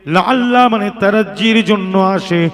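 loud, sing-song sermon chant with drawn-out phrase endings; ওয়াজের সুর. Real, not acted. Chanted recitation and translation. (সুরেলা উচ্চকণ্ঠে ওয়াজ)

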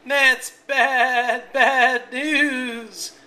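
high, loud bleating sheep voice; baaing sheep. A narrator's reading. Sheep lamenting to rooster, bleating. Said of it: "baaed the sheep".

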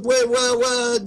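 usual speaking voice

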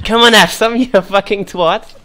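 loud, high British football-hooligan roar; British accent. Pretend. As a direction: scream it like a drunk English fan. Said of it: "I can do a British accent".

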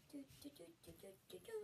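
quick soft hummed tune; humming jingle. Real, not acted. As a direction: hum while opening the box. 開封中の口ずさみ. (小声で口ずさむ)

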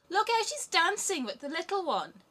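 high, loud jeering; mocking robbers. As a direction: robbers laughing at the hopping mother. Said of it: "the robbers laughed and said".

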